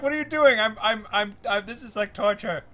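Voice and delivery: high, loud mock frustration; exasperated outburst. Producer behind the glass losing patience at endless retakes, loud and high. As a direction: blurt it out like a director at wits' end. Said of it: "I was like".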